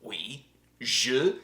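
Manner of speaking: halting mock French accent; a bit of a French accent; French accent attempt. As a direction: try the words in a French accent. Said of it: "my French accent is going like".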